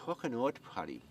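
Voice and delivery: Irish mate. Retold or imitated. An Irish mate calling out to him, Irish accent. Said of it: "he says".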